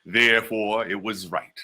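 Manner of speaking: ordinary delivery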